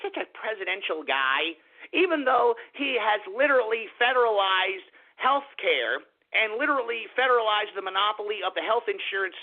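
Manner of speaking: lightly voiced smug liberal; a hint of a sneering impression of his opponents; mocking liberal voice. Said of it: "each and every one of you morons were out here saying".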